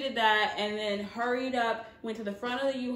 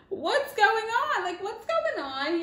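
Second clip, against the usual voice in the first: high, slow, laughing disbelief; baffled; 10 semitones higher, 4 dB louder, slower than usual. Retold or imitated. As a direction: act out your confusion at the driver. Confused by driver passing her.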